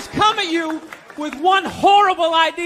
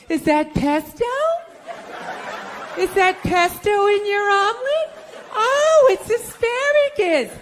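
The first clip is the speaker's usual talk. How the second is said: vapid brunch friend; 7 semitones higher than usual. Retold or imitated. Friends' moronic brunch chatter.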